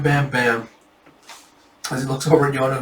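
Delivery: ordinary delivery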